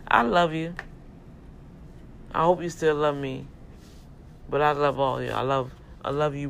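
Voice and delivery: fervent sermon close; loving preacher sign-off; preaching. Acted. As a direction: lift them up with love and faith. Warm closing sermon.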